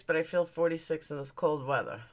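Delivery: ordinary talk, nothing marked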